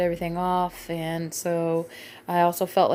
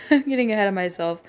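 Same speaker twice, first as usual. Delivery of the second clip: ordinary talk, nothing marked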